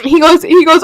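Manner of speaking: ordinary talk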